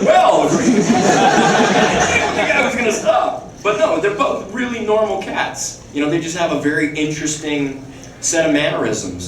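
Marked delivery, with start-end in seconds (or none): 0.0-3.4 slow, deliberate mock line reading; a drawn-out imitation of the co-star; slow acted imitation. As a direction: act it out slowly like a halting co-star. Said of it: "I acted with him like this".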